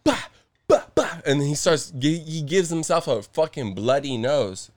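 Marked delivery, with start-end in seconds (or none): 0.0-1.2 loud, high punched "bah" noises; imitated smacks. Retelling the man hitting himself with his cast, imitated smacks. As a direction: bark out each blow as a separate hit. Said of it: "cracking himself in the face".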